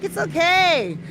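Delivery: high, soothing sing-song comfort; calm yourself down kindly; soothing high self-talk; reassuring self. Retold or imitated. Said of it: "And I'm like".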